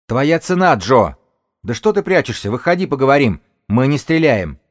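high, loud, projected call. Acted. Woman leader calls the rival out, high loud shout. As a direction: coax him out at full voice.

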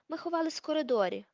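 ordinary delivery, nothing marked

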